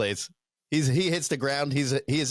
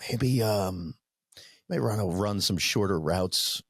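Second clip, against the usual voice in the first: gentle league official; 5 semitones lower than usual. Retold or imitated. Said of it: "basically gently telling me".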